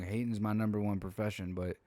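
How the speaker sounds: usual speaking voice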